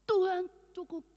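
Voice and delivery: slow, piercing cry of "enough"; cried out. Retold or imitated. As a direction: scream for it all to stop. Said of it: "saya teriak".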